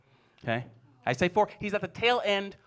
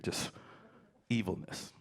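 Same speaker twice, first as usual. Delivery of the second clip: usual speaking voice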